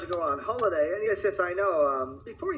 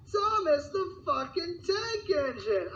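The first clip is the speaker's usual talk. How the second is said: much higher, slow, drawn-out reveal; triumphant reveal of his secret weapon; dramatic reveal; 13 semitones higher, slower than usual. Acted. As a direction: unveil the name grandly.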